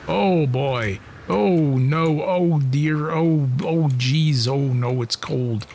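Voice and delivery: hammed-up Canadian accent with drawn-out vowels; bad Canadian accent. Player moaning about the cold in game, bad Canadian accent. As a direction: do a badly faked Canadian accent, slowly. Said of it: "my really bad attempt at some kind of, some kind of Canadian accent".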